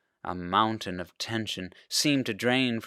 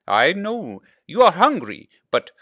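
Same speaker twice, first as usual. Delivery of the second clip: kind father; 8 semitones higher, 5 dB louder than usual. Acted. Father teasing hungry boy.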